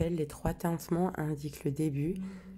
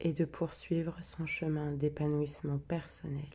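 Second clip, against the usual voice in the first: hushed, prayer-like recitation of the intention; solemn invocation. Acted. Healer opening the sacred circle for the group, hushed and solemn.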